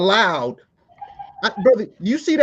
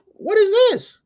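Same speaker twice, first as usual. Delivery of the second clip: high, shocked mother's voice; a mother squeals, shocked at the note; mama's shocked question; 14 semitones higher than usual. Retold or imitated. Mother reads the teacher's note, high and shocked. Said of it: "she like".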